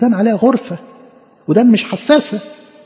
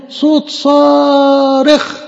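long, high imitated cry; 7 semitones higher, much slower than usual. Preacher voices John the Baptist's cry, drawn-out calling. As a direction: stretch it out high like a herald crying. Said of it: "بتصرخ ليه يا عم؟".

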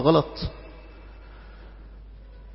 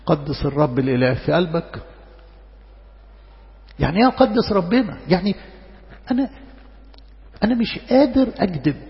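ordinary talk, nothing marked

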